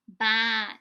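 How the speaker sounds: loud bleating sheep voice; a sheep answering the man. Acted. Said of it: "said Wooly".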